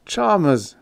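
high-pitched appeal; urgent, imploring call; pleading. A narrator's reading. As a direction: plead with him to calm down. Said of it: "I pleaded to quiet him".

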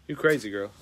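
usual speaking voice

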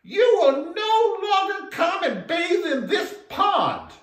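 high, loud and slow command; little frog crying out. Acted. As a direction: shout it up high like a tiny brave frog. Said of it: "the little frog cried out".

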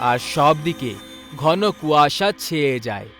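usual speaking voice

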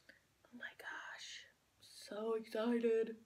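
breathy excited whisper. Real, not acted. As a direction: hush it slowly with glee. Hushed excitement over fondue.

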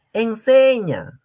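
drawn-out emphatic enseña; 1 emphatic command. Real, not acted. Teacher urging students to share, emphatic. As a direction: stretch and punch enseña.

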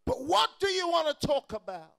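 loud, chanted preacher's climb over the music; sing-song preaching. Acted. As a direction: ride the organ to the peak. Preaching at full force, sing-song.

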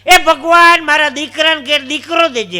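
high, loud invocation; loud plea to God. Acted. Old woman at temple asks God for grandson, calling out loudly. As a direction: call out a bold request to heaven.

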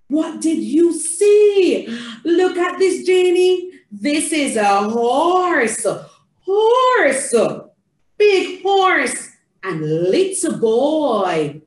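slow, stretched storytime words; name each picture grandly for a toddler; theatrical reading to a child. Shown as a demonstration. Showing Janie the horse, slow and theatrical.

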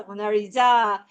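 "모나리자"의 "자"를 길고 세게; 느리게, "모나리자"의 "자"에 강세; 끝음절 강세. Shown as a demonstration. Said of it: "이게 강세잖아". ("모나리자"의 "자"를 세게 끌어서)

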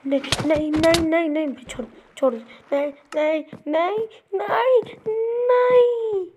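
cries of no building to a high loud wail; plead, then escalate to a wailing scream; desperate crying out. Pretend. Gamer caught in game, pleading builds to wailing no.

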